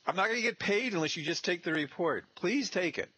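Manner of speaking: high, whiny begging for the report; pleading intern. Retold or imitated. Intern begging the homeowner, high and whiny. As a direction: plead like you won't get paid otherwise. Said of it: "I said".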